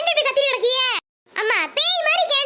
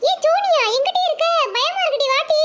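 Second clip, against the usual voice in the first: scared calling; 5 semitones higher than usual. Acted.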